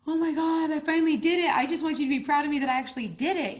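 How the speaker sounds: high, loud, gushing excitement; eager plea. Retold or imitated. New author begging readers for approval, excited. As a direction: beg for pride, voice lifted high.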